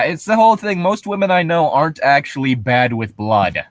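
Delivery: ordinary talk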